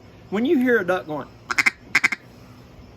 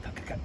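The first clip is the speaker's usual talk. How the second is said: soft "cuh, cuh"; quiet mouth call; close feeding call; 12 dB quieter than usual. Shown as a demonstration. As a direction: mimic a duck feeding nearby. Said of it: "they'll be going through there going".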